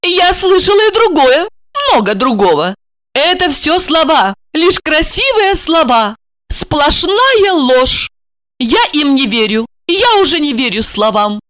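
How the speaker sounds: high-pitched, bitter woman; disbelieving wife. Acted. Wife no longer trusts her husband's words, disbelieving. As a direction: reject his promises with hurt insistence.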